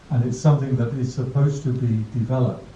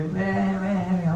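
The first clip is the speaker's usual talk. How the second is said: put-on whiny baby; 7 semitones higher, 4 dB quieter than usual. Imitating a baby's fussy whine.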